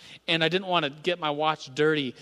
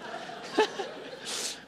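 high breathy giggle; 6 dB quieter than usual. Real, not acted.

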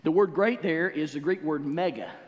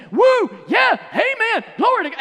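much higher and louder, shouting praise; ecstatic church shout; 13 dB louder than usual. Retold or imitated. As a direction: let the praise burst out of you. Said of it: "you say".

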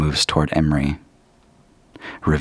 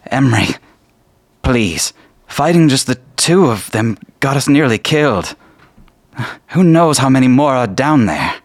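high, loud desperate plea to a friend; pleading shout; 9 semitones higher, 7 dB louder than usual. Acted. Amaranth begging Emery to turn back, pleading shout. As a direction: beg him loudly not to go on. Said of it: "You hear Amaranth say".

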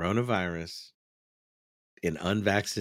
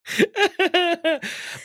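high, loud burst of laughter; 6 dB louder than usual. Real, not acted. Host cracking up before the PSAs, loud laugh. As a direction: crack up loudly.

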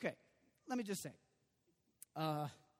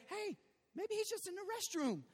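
high-pitched mock-helpful suggestion; Elijah; 12 semitones higher than usual. Elijah crudely teasing prophets about Baal, taunting. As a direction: tease in a high, innocent voice. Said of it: "Elijah began to taunt them".

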